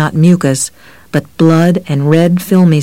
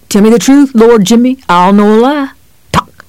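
raised, menacing cop; 6 semitones higher, 4 dB louder than usual. A narrator's reading. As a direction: press him hard like a bullying cop.

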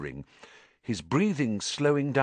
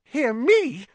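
squeaky, much higher, frightened; unnaturally high voice; 5 dB louder than usual. A narrator's reading. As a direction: let the voice jump up in fear. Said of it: "in an unnaturally high voice".

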